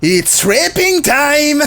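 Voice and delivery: very slow, high put-on villain line; hammed-up menacing villain; villain voiced. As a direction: drag it out like a creepy cartoon baddie. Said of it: "il fait comme ça".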